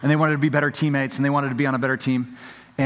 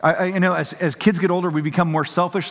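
usual speaking voice

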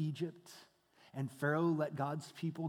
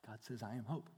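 much softer, almost whispered; soft hush; 7 semitones lower, 13 dB quieter than usual. Acted. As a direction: lean in and say it softly. Whispered answer from God.

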